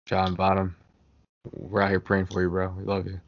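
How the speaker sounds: ordinary talk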